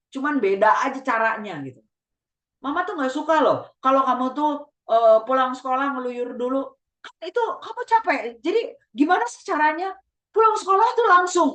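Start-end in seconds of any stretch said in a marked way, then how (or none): 2.6-11.5 high-pitched, insistent parental lecture; long-winded nagging mother. Acted. Mother scolding child for wandering after school, high and nagging. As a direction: nag on and on, pitched well up. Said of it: "orang tua yang ngomongnya kepanjangan".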